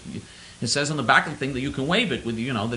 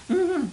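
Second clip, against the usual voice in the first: short high falling mm-hmm; mocking yes hum; 13 semitones higher than usual. Shown as a demonstration. Caller demonstrating a yes, high mocking hum. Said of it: "this is how they say yes".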